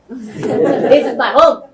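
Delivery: high, drawn-out mock kid's shout; imitated defiant shout. Retelling a child facing soldiers, imitated defiant shout. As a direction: cry out slowly and loudly like a defiant child.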